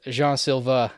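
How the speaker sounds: slow, accidental French accent on the name. Real, not acted. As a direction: slip into French on "Jean", like "zhahn". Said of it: "I keep adding the French".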